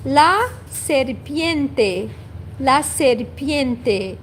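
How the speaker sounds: "la serpiente" drawn out syllable by syllable; slow spoken model of "la serpiente"; slow careful demo. Shown as a demonstration. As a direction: teach "la serpiente" slowly.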